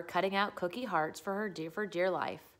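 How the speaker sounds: ordinary delivery, nothing marked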